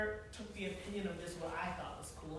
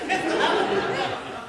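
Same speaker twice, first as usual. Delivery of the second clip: burst of laughter; 6 semitones higher, 11 dB louder than usual. Real, not acted. Laughing at the wrong name.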